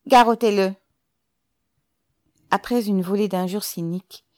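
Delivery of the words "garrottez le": loud, high command; raised-voice command to seize him; barked order. A narrator's reading. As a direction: order the guard loudly. Commissioner orders an arrest.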